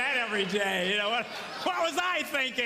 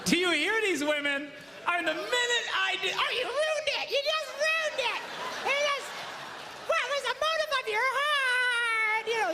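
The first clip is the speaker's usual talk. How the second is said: high, loud mock reproach; hammed-up outrage at the husband's motive; mock scolding women; 4 dB louder than usual. Mocking women scolding from audience.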